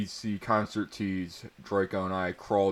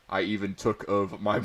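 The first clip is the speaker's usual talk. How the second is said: ordinary delivery, nothing marked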